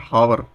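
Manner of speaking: हावर with a clear h at the start, showing the common mistake; wrong pronunciation. Shown as a demonstration. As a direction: say हावर pronouncing the h. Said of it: "कई लोग इसको क्या बोलते हैं". (हावर में h साफ़ बोलें, ग़लत उच्चारण)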